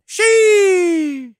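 high, loud shouted "She"; meme shriek. Pretend. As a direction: screech it like the meme. Screeching meme sound.

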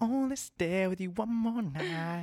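high, put-on lover's plea; dramatic lover. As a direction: melodramatic romance voice. Mock dramatic lover's line.

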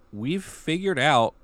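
usual speaking voice

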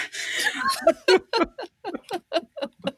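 high, breathy burst of laughter; helpless high laugh. Real, not acted. Laughing at her own blunder, high and helpless.

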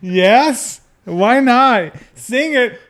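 high, loud ecstatic cries; hammed-up shouts peaking in a drawn-out cry; mock climax shouting. Playing along with climax joke, high ecstatic shouting.

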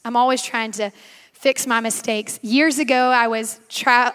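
ordinary delivery

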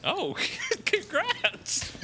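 laughing congrats. Real, not acted. Laughing at the birthday joke.

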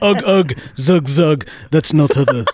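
a hint of a grunting, stuck-up caveman; snobbish caveman voice. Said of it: "that is how the snobbish caveman would have sounded".

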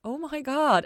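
high, loud stunned gasp of words; high loud disbelief; shocked. A narrator's reading. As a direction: hit by the realization. Ella stunned by revelation.

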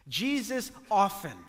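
ordinary delivery

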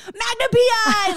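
high, loud excited recognition; excited shout. Retold or imitated. Guest recognizing costume, excited shout. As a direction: shout it like a thrilled fan. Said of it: "three people were like".

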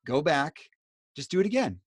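rising, urgent stop and go back; urgent self-correction. Retold or imitated. Halting herself mid-mistake to start over, urgent. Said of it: "the critique comes in and it's like".

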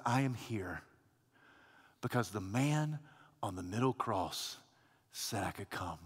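the thief answering the angel, hushed and humble. Acted. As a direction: say it almost under your breath, in awe.

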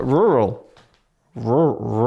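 rural breaking off into repeated rur-, rur-; failing at rural. Real, not acted. Host admits he can't do it, tripping over rural. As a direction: stall on the first syllable of rural, retrying. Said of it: "I can't do it".

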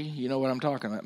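normal talking voice

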